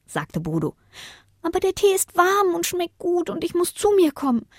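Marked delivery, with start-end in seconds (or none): 1.4-4.5 put-on high child voice asking for a drink; small boy pleading for a sip, pitched well up; young boy voice. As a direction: voice the thirsty little boy high. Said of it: "sagte der Junge".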